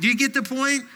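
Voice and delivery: playful demand to the congregation; emphatic challenge. Real, not acted. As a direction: needle the crowd a little. Pressing congregation for the point.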